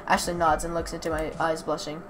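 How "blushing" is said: plain reading voice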